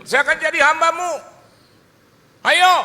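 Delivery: high, full-voiced yell; desperate vow. Father shouts frantic promises to God, desperate yell. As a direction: bargain with God at the top of the voice. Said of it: "keluarlah itu janji-janji".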